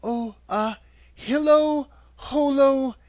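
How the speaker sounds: put-on high girl's voice, hesitant incantation; halting, high recitation of a charm; Dorothy. A narrator's reading. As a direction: sound out magic words one by one, pitched high. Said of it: "Dorothy went on".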